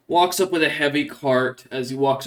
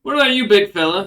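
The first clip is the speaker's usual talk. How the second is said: loud, higher guard voice; raised-voice, offhand challenge; guard addressing a prisoner; 8 semitones higher, 8 dB louder than usual. Acted. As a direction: call over to a prisoner. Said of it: "looks over at Ofa and goes".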